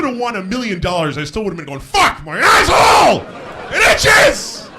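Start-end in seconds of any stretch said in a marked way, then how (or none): 1.8-4.7 very high, loud comic yell; anguished shout. Acted. Comic acts out his maddening itch, screaming in agony. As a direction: scream it in exaggerated torment.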